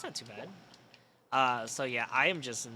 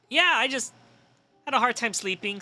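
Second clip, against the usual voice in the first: higher, louder, overly bright excuse voice; mock excuse; 10 semitones higher, 8 dB louder than usual. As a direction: sell the excuse to the boss. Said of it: "And I went".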